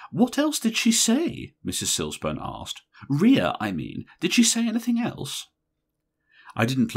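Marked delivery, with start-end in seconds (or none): 0.1-5.5 a nervous society woman prying; timid eager question; Missus Silsburn. A narrator's reading.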